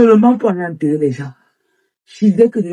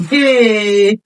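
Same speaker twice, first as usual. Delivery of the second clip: crying wail; 4 semitones higher than usual. Acted. Woman lamenting her failed year.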